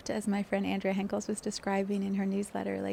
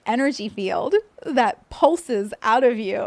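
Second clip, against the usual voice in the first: dreamy enraptured tone; 2 semitones higher, 8 dB louder than usual. Retold or imitated. Quoting enraptured woman.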